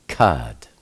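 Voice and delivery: kad as one low, falling syllable, K to D; sounded out word. Shown as a demonstration. Teacher blending Kaf and Dalet, sounded out as kad. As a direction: join K and D into a low-pitched kad. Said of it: "Kaf is pronounced K".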